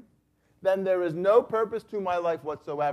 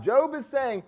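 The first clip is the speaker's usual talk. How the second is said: ordinary delivery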